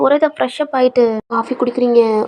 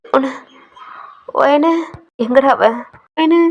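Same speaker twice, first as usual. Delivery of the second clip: distraught man weeping; crying; 5 semitones higher, 4 dB louder than usual. Acted. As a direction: wail it out for the lost brother-in-law. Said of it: "அழுகுறான்".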